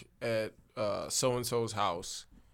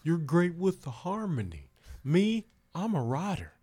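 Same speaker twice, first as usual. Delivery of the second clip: higher put-on voice, a touch louder; band guy voice; 7 semitones higher, 4 dB louder than usual. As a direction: boast about the band, lightly voiced. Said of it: "And he's like".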